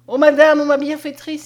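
loud, high cry of gratitude. A narrator's reading. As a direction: burst out, overwhelmed with thanks. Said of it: "s'écria-t-elle".

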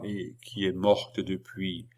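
soft, slow confession; hushed, reluctant admission. A narrator's reading. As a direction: drop the voice and slow right down. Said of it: "André baissa la voix".

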